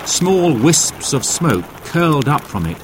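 ordinary delivery, nothing marked

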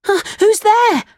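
put-on very high puppet-boy yelp; high, surprised Pinocchio; startled cry. A narrator's reading. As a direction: call out startled, pitch shooting up. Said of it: "cried Pinocchio".

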